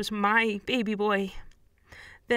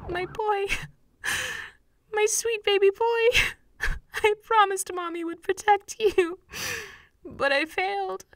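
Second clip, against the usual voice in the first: sobbing grief; 11 semitones higher than usual. Acted. Mother grieving her dead son.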